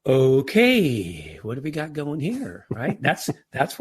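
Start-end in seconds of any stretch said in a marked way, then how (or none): 0.0-2.6 drawn-out, measured okay, then an easy question; composed reaction. Shown as a demonstration. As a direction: take it in stride, stretching the okay. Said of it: "same words in a different tone".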